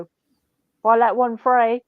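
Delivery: slow mock warning to the dog. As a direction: spell it out slowly to the dog. Warning the dog about tarantulas.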